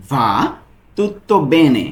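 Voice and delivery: "va tutto bene" spelled out very slowly; slow down so learners catch each sound; slow, syllable by syllable. Shown as a demonstration. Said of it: "এর Italian কি হবে?".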